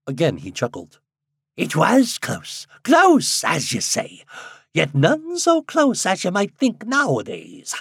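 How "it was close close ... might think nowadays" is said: put-on high, loud old countryman; old man voice. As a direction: speak as an excited old villager. Old man chuckling reply.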